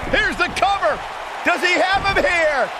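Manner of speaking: rising, urgent call of the cover; excited pin call. Real, not acted. Commentator calling the pin to the crowd, rising excitement. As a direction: build the tension as the count starts.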